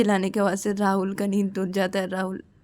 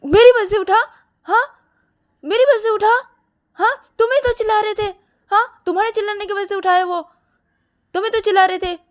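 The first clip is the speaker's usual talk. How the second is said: high, loud counter-accusation; indignant retort; 13 semitones higher, 8 dB louder than usual. Acted. Siya denies waking him and blames Shruti, indignant. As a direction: throw the blame back, shrieked pitch.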